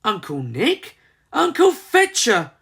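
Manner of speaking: high alarmed cry. A narrator's reading. Molly calling out for her uncles in alarm. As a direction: call out in alarm. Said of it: "cried Molly".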